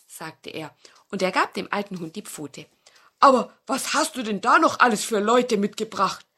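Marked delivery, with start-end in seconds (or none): 3.2-6.3 louder, odd fox voice; curious old fox. Acted. Fox asks who the dog brought, curious fox. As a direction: ask suspiciously like a fox.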